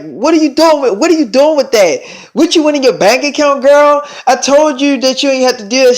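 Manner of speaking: high-pitched acted-out telling-off; alarmed scolding. Friend questions Selena about the money, alarmed scolding.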